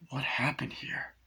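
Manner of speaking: a soft whispered question, breath only. Retold or imitated. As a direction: whisper it like sneaking into a room. Whispered retold line.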